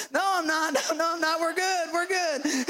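high-pitched, frantic mock reassurance; acted high, strained insistence; flustered dad. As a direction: reassure wailing kids while panicking. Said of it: "I'm driving backwards going".

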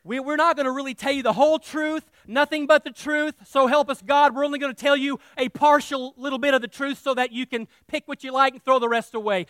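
usual speaking voice

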